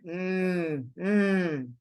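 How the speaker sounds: long hummed mm; circumflex sound. Shown as a demonstration. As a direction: draw out mm as one held tone. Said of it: "omega with the circumflex sounds like".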